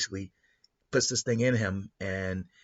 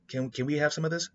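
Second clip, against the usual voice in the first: higher, eager asking voice; eager genius; 7 semitones higher than usual. Retold or imitated. Genius examining tech, eager and higher. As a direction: beg a little, wide-eyed.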